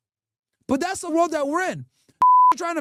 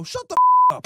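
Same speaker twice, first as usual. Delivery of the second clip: much higher, much louder screech; shrieking yell; 8 dB louder than usual. Acted. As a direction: shriek it like a sudden meltdown. Shrieked outburst.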